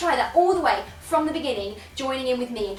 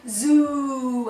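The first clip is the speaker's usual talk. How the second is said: long, sustained, sliding zoo; deep voice; 6 dB louder than usual. Shown as a demonstration. Teacher leads voice warm-up, deep held note. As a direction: hold the vowel in deep voice. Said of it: "in a really nice deep voice".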